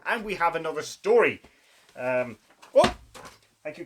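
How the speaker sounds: ordinary delivery, nothing marked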